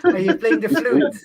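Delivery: high-pitched question through laughter; amused teasing. Real, not acted. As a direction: tease a friend while laughing, voice riding up high. Teasing Danny amid laughter.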